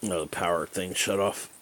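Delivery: usual speaking voice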